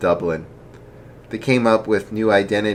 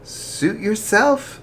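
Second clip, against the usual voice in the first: smiling, unbothered; 14 semitones higher, much slower than usual. Acted.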